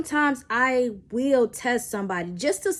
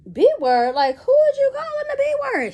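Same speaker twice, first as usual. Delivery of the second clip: loud, much higher indignant demand; offended outrage; 7 dB louder than usual. Retold or imitated. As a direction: fire it back, insulted. Offended at being called bitch.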